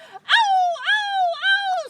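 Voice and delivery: repeated high, loud "Ow!" howls; pained howls. Acted. Robber beaten by rooster's wings, high pained howling. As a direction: howl in storybook pain.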